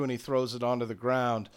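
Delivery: ordinary talk, nothing marked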